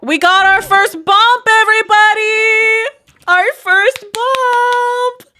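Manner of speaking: high, slow, drawn-out cheer announcing the news; big excited announcement to the viewers. Real, not acted. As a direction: stretch it out like a victory shout. Celebrating the first baby bump.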